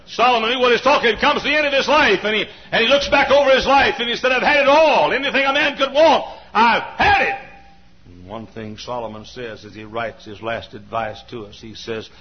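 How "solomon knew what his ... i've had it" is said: high, loud preacher's cry; full-force preaching. Acted. Preacher telling the congregation of Solomon, preaching at full force. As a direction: drive it home to the pews.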